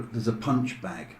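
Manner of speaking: ordinary delivery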